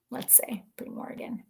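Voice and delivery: hushed, cautious; soft unvoiced test; whispered. Real, not acted. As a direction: whisper it as you type. Whispers while typing a search.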